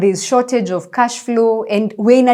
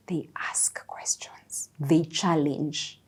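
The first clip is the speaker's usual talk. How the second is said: slow, softer whisper, pressing "they ask questions"; 4 semitones lower, 13 dB quieter, slower than usual. Real, not acted. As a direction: lean in and hush "they challenge". Stressing how colleagues behave.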